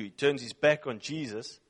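usual speaking voice

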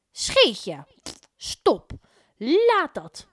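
loud, rising scold. A narrator's reading. Mevrouw Poepies scolding Scheetje, loud and sharp. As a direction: tell the dog off firmly.

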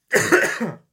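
sudden loud choking cough; coughing on a drink. Real, not acted. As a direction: cough as a drink goes down wrong. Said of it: "Excuse me. A little bit of Sprite went down the wrong way".

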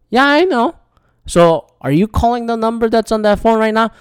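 high-pitched, politely contained comeback; retold calm reply. Visitor answering a yelling stranger, high mock-calm voice. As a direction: imitate yourself answering calmly, pitched high. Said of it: "I just calmly said".

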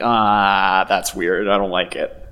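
low, drawn-out mock distaste; retold uneasy reaction. Older actor reacting to colleague's American accent, mock displeased. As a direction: sink low in put-on disapproval. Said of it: "was like".

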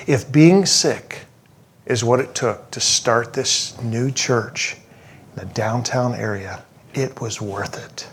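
slow, hushed, earnest words; solemn acceptance. Retold or imitated. Wife telling her husband her sickness was worth it, solemn acceptance.

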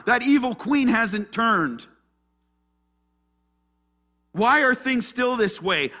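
high, loud protest, easing at the end; Elijah's despair. Acted. Elijah asks why nothing has changed, loud despair. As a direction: cry out in frustration at God. Preacher voices Elijah's complaint.

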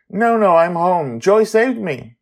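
put-on high, loud boy voice; boy reassuring her; Charlie's voice. Charlie telling Amali he is home, high boy voice.

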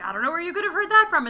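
high, fast, nervous denial; mock flustered denial. Friend mimics Marta denying to the detective, flustered and high. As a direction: blurt it out, caught in a lie. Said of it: "And she's like".

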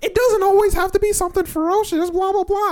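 put-on high, loud prissy girl voice; mock girl. Boy mocks the classmate he disliked, high prissy voice. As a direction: mimic her lecture in a high prim tone. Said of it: "this girl is".

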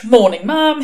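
loud, high, lilting greeting; exaggerated sing-song politeness. A narrator's reading. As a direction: greet in a mock-cheery lilt. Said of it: "he said in a sing-song voice".

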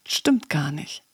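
lightly voiced inner doubter; understated, dismissive contradiction; a hint of a doubting inner voice; small inner voice. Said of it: "so eine kleine Stimme in dir drin".

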